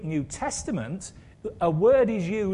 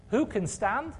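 ordinary delivery

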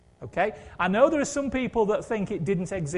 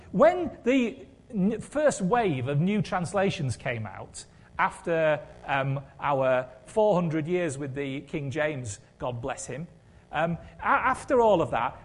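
normal talking voice

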